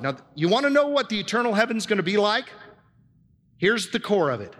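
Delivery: usual speaking voice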